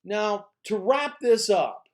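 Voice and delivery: slow the words down and mark the wrap-up; speech conclusion demo. Shown as a demonstration. Teacher showing students how to signal the end, slow and deliberate. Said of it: "and you say".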